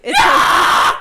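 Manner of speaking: very high, loud screamed quote; mock scream. Girlfriend correcting how she was quoted, exaggerated scream. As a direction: shriek it as an over-the-top retelling. Yells own version over partner.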